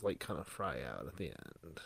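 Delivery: low, soft creak trailing to the end; vocal fry. Shown as a demonstration. Podcaster explains how vocals fry out, demonstrating the creak. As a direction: let the voice sink and crackle out. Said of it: "they just kinda let the vocals".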